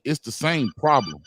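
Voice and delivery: ordinary delivery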